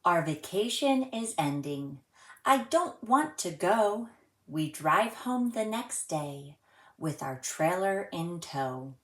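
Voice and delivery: gentle sing-song storybook reading; reading to children. A narrator's reading. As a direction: read it like a rhyme for little kids. Reads a rhyming picture book aloud.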